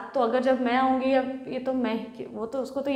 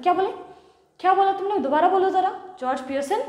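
loud, higher, daring him; loud furious wife's challenge; angry challenge; 7 semitones higher, 3 dB louder than usual. Acted. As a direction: hands on hips, dare him to repeat it. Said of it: "Mrs Pearson कहती है".

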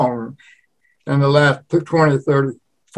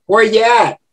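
higher-pitched New Orleans "where y'at"; New Orleans Yat accent; 7 semitones higher, 4 dB louder than usual. Shown as a demonstration. New Orleans local greeting someone, higher Yat accent. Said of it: "They call that yat speak".

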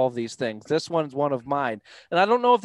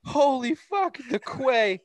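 stunned; 11 semitones higher, slower than usual. Real, not acted. Still stunned at mistake.

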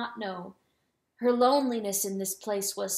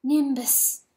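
higher cry of the name; 4 semitones higher, 4 dB louder than usual. A narrator's reading. Hen hearing who is dead, crying out. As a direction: echo the name as a lament. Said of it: "cried the hen".

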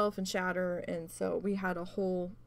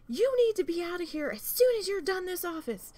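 imitating a manager's high, loud tirade; retold screaming; 11 semitones higher, 5 dB louder than usual. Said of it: "screaming at me".

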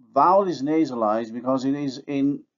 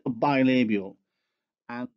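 ordinary talk, nothing marked

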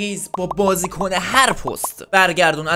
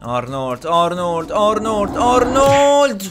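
building commentator excitement; 6 dB louder than usual. Real, not acted. Gamer cheering Arnold's run on goal, building excitement. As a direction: build it up to a shout on the last name. Chanting player's name as he attacks.

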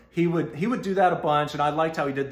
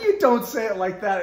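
mock protest; 8 semitones higher, 7 dB louder than usual. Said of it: "I was like".

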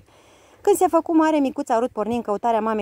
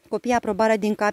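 plain reading voice

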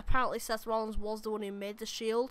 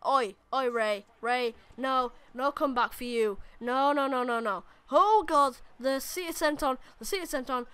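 excited wrestling commentary, higher and louder; live play-by-play; 4 semitones higher, 5 dB louder than usual. Acted. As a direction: call every move as it happens. Live commentary on his own match.

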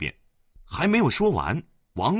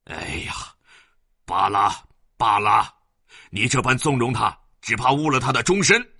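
louder, fed-up scolding; frustrated husband, louder grumble; exasperated father; 3 semitones higher, 5 dB louder than usual. Acted. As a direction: throw up your hands in anger. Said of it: "员外只气得开口不得".

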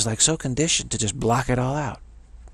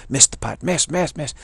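retold heckling scream; 5 semitones higher, 4 dB louder than usual. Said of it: "that guy screaming at you".

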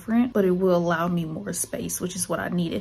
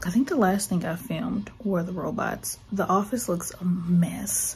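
whispering. Real, not acted. Breathless vlog whispers.

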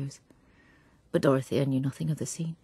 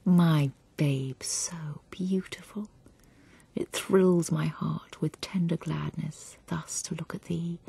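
tender poem reading; slower than usual. A narrator's reading. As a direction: read it as if beside a sleeping baby. Reads a tender lullaby-like poem.

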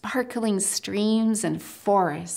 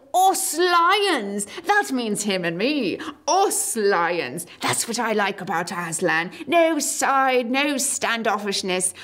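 excited proud lion. Acted. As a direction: boast to everyone, fast.

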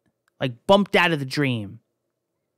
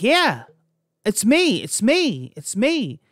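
insistent, higher-pitched retold plea; eager repeated call, pitched up; urgent calling; 6 semitones higher, slower than usual. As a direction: act out trying desperately to be noticed. Ghost calling to grieving parents.

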